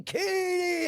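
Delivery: high imitated holler; yelling a name. Woman in chains nearing the cell, yelling a name. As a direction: call the name out, high and held. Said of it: "yelling my name".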